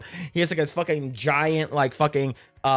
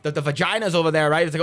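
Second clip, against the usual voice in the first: ordinary talk, nothing marked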